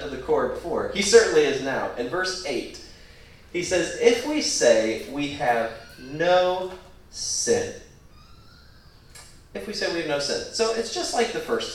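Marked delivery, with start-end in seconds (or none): 4.0-7.7 drawn-out, deliberate quote, each phrase weighted; spaced-out words, stretched for emphasis; slow, emphatic reading. Shown as a demonstration. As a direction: read it out slowly and solemnly. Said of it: "The emphasis is on the verb".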